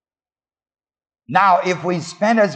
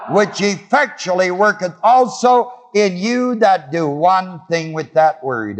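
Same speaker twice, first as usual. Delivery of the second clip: raised, sustained preacher cadence with call and response; preaching; 5 semitones higher than usual. Acted. Preacher calling out to his congregation about the Word. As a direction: preach it, drawing answers from the congregation.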